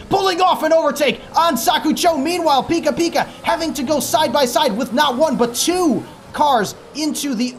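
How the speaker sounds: excited commentary. Real, not acted. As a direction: call the overtake with rising excitement. Commentator calling an overtake.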